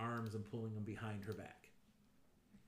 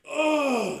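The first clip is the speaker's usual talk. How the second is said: loud, high yelp of pain; pained cry; 17 dB louder than usual. Acted. GM voicing the bleeding guard, sudden loud yelp. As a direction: cry out as the spell hits. Enemy hurt by spell.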